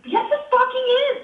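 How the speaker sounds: very high mock-outraged comeback; indignant insistence. Camper insisting it is their tent, high indignant comeback. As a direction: squeal back in retold outrage. Acted quarrel over the tent.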